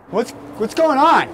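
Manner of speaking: high, slow, drawn-out question; mock high-pitched calling voice; imitated crackhead. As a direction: play the crackhead calling out in confusion.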